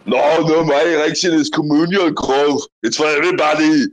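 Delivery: comic Austrian strongman protesting; hammed-up mock-Austrian accent, emphatic; Arnold. Correcting the comedian's joke, boastful Arnold impersonation. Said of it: "Arnold impersonation".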